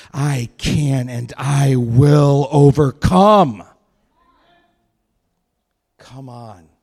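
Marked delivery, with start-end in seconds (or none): 0.1-3.6 loud, slow, resolute vow; firm declaration. Shown as a demonstration. Pastor voicing the believer's victory, in a slow loud resolute vow.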